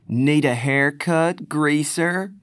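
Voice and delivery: high, slow sneering taunt; mocking thug. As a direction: tease him cruelly, dragging it out. Greaser taunting with a knife.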